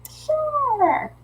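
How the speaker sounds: very high, drawn-out "sure"; perky agreement. Retold or imitated. Saying yes to a free trial, high playful agreement. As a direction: sing it out high and long, then fall. Acted yes to the email offer.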